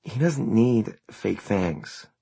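a touch of slow exasperation; irritable. A narrator's reading. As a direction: draw it out with irritation.

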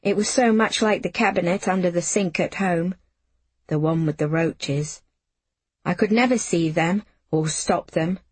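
plain reading voice

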